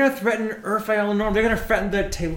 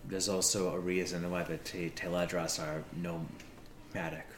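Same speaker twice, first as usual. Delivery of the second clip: soft reassurance; 12 semitones lower, 16 dB quieter than usual. Acted. Soft reassurance after argument.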